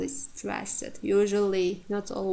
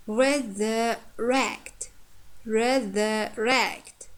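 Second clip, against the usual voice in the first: very slow "resurrect", stress on "rect"; drawn-out teaching "resurrect" with a strong ending; stress on last syllable; much slower than usual. Shown as a demonstration. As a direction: break "resurrect" up slowly and hit the final "rect". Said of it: "last syllable is stressed".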